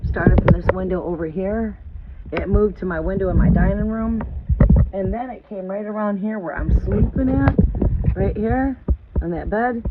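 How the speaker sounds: worked-up storyteller reliving the scare; building excitement. Real, not acted. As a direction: tell it like it's happening again. Retelling the midnight noises.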